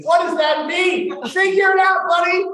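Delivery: high, loud, frantic retort; exasperated shout. Acted. Panicked man answers his own brain, exasperated high shout. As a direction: yell back in exasperation, voice way up.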